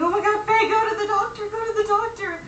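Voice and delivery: hammed-up alarm, piling on the advice; mock panicked friends. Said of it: "they were all freaking out".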